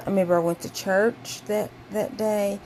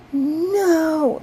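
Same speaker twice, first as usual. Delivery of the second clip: high, loud angry cry of no; furious high-pitched no; mad no; 9 semitones higher, 7 dB louder than usual. Retold or imitated. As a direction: yell it out, disgusted and mad. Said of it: "I was mad".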